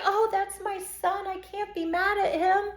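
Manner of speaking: high, soft doting mother; mock indulgent mother. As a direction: coo it like a mother excusing her son. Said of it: "mother's excuse".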